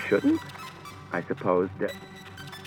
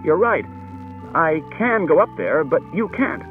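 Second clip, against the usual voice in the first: raised voice; 7 semitones higher than usual. A narrator's reading. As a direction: push it out firmly. Bailey over the noise.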